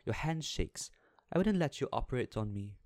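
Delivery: high, loud and fast tirade; violent outburst. A narrator's reading. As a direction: lash out at the doctor, rising into a yell. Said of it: "cried Miss Baedeker violently".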